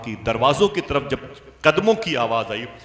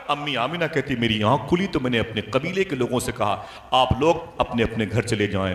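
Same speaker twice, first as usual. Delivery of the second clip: ordinary delivery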